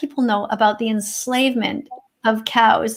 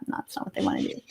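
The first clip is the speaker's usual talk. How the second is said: muttered aside; 12 dB quieter, faster than usual. Real, not acted. Snide aside about the reporters.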